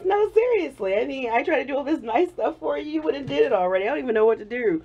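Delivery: high, giggly quote of his protest; quoting through laughter. Retold or imitated. Quoting her boyfriend's protest, laughing and high-pitched. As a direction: quote his fluster while still laughing. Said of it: "I keep laughing".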